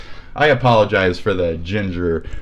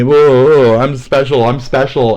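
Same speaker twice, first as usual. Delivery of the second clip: loud, higher sarcastic gushing; mock excitement; 5 semitones higher, 6 dB louder than usual. Mocking a commenter, sarcastic and loud. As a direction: gush about being special.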